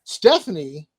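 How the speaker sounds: ordinary talk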